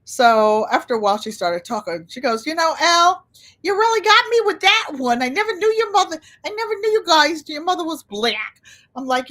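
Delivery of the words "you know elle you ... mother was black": much higher, louder voice for Katie; imitating Katie. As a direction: act Katie laughing it off. Katie admits, acted.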